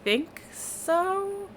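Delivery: put-on uneasy young man. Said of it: "And he's like".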